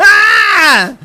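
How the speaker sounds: high loud shriek like a siren; imitating a personal alarm with one piercing yell. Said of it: "sound alarm".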